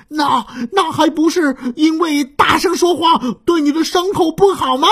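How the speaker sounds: high strained shout, a touch fast; straining shout. Acted. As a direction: shout it with all his strength. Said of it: "扯着嗓子喊". (扯着嗓子喊)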